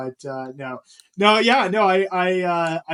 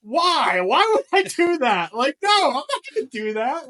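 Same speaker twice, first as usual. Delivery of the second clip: high incredulous protest; 10 semitones higher, 5 dB louder than usual. Retold or imitated. Refusing his wife's embarrassing video idea, high and incredulous. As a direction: squeal a refusal. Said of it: "I was like".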